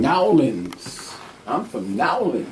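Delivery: "Nowlins" in a swooping New Orleans drawl; slow local pronunciation, "NAW-linz" for New Orleans; New Orleans accent. Retold or imitated. Showing friends how locals name their city, New Orleans accent. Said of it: "That's how they say it down there".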